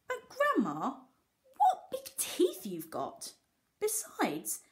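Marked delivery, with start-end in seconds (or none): 0.1-3.3 high, slow and playful, a little laugh in it; mock wonder. Postman teasing the grandma-wolf, slow high playful voice. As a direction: stretch it out with a giggle.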